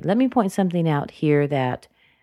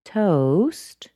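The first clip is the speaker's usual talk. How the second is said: "toast" swooping up at the end; unfinished, rising "toast"; rising intonation; 3 semitones higher than usual. Shown as a demonstration. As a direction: lift "toast" as if more follows. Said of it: "She's continuing on".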